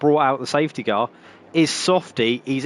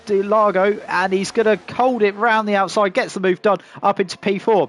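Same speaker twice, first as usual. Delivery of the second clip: higher, lively live race call; motorsport commentator; 5 semitones higher than usual. Acted.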